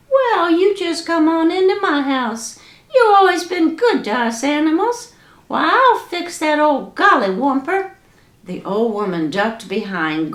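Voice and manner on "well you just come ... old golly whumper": high critter voice; high-pitched friendly groundhog. Acted. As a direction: squeak it out like a small groundhog.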